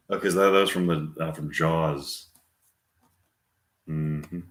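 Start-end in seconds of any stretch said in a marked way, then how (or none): none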